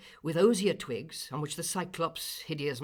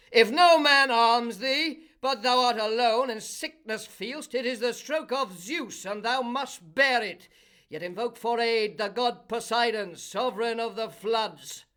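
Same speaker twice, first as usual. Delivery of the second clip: Cyclopes answering; 8 semitones higher, 9 dB louder than usual. A narrator's reading. The Cyclopes advise Polyphemus, loud raised call. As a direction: call back gravely.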